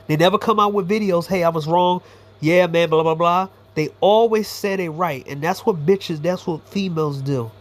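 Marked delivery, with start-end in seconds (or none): none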